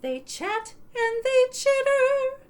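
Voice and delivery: put-on lilting sing-song read to kids; sing-song picture book reading; storybook read-aloud. A narrator's reading. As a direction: read it like bedtime story time, half singing.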